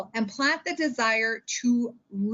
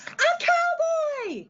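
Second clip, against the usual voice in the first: much higher, drawn-out slow exclamation; excited cowboy; much slower than usual. Shown as a demonstration. Teacher showing kids how to read expressively, excited and slow. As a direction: stretch it out with big delight. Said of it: "they want you to say it loud".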